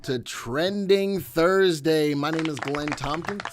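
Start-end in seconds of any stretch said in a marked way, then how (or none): 0.0-2.1 big, bright hello pitched high and loud; energetic show greeting. Real, not acted. Host opening his live stream with a loud, high welcome. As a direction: throw the greeting out to the whole audience.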